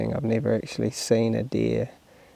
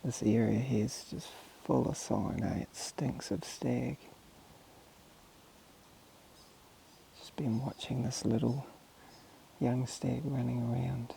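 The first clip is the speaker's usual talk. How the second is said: hushed stalking voice, much softer; wildlife watching whisper; 9 dB quieter than usual. Real, not acted. Stalking deer, whispers after long pause.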